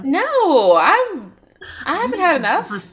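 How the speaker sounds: high and loud, eager protest; excited. Real, not acted. Eager reassurance to cohost.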